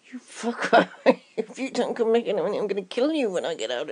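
laughing mock curse; half-laughing threat. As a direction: curse him through laughter.

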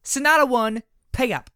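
high, loud yell; filly yelling into the post office. Acted. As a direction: call it into the building. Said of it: "she yelled into the post office".

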